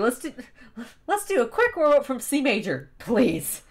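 desperate plea to start from C major. Shown as a demonstration. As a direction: plead for the warm-up as if desperate. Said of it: "Like, desperately".

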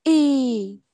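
Y drawn out as a long "ee"; long vowel. Shown as a demonstration. Teacher showing the longer reading, Y held long. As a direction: hold Y long, strong at the start. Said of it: "mình đọc nó dài hơn xíu".

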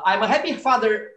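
ordinary talk, nothing marked

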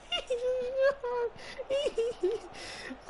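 very high squealing laughter; laughing to the point of tears; shrieking helpless laugh; hysterical laugh. Real, not acted.

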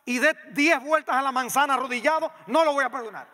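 hammed-up grudge, demanding he crawl back; indignant refusal. Preacher voicing an offended believer, loud mock indignation.

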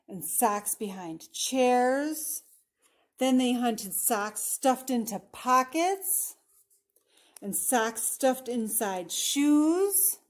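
deliberate picture-book narration, stretching key words; storybook voice with drawn-out line endings; story reading to children. A narrator's reading. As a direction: read it out expressively for young listeners. Reads picture book aloud.

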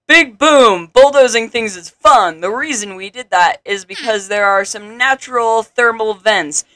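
loud, high opening, easing into explanation; lightly theatrical tutorial guide; gleeful town advisor. Acted. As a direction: announce it with relish as a game character. Voices the game's advisor.

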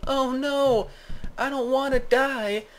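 high, slow hammed-up whimper; playful cartoon fear of dying; mock dying wail. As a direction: whine it slowly in a high voice. Injured character in improvised story.

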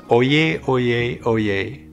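ringing herald's cry, fading each time; town crier shout. Retold or imitated. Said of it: "they would often shout".

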